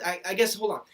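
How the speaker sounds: ordinary delivery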